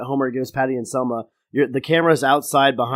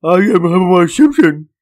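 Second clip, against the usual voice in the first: loud barky dog voice; 4 semitones higher, 8 dB louder than usual. Acted. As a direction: say it like a dog would. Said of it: "in a dog's voice".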